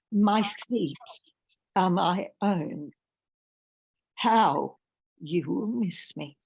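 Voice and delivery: poetry reading. Acted. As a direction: let each line breathe like a remembered scene. Reads her poem aloud.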